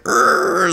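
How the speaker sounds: straining, grunted mock scream; imitated scream. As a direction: imitate a kid screaming with effort into the mic. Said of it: "he screamed. He screamed in the mic".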